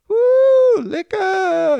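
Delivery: high, loud whoop of a vacationer; party whoop. Retold or imitated. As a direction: whoop it up for the booze. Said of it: "you're just like".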